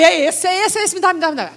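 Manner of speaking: eager, loud and high, grabbing it; can't wait, give it to me now; excited. Retold or imitated. Preacher retelling her eager demand for the dress.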